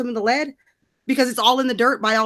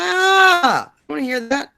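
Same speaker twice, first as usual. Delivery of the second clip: mock exasperation; 7 semitones higher, 5 dB louder than usual. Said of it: "I'm like".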